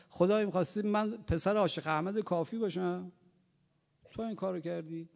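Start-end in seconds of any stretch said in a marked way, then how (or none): none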